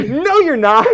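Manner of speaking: high, loud mock denial; shriller-pitched imitation of scoffing girlfriend; girlfriend's loud disbelief. Girlfriend slapping him again, mock loud denial.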